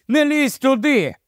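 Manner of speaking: high, urgent warning cry; shouting. A narrator's reading. Narrator warns Eddie away from the labyrinth, crying out. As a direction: cry it out high to stop him. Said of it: "закричав я".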